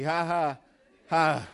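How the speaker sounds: a forced, uneasy ha ha ha; nervous forced laugh. Acted. Isaac nervously laughs at his father holding a knife, forced laugh. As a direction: laugh it off nervously, not quite amused.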